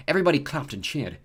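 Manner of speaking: usual speaking voice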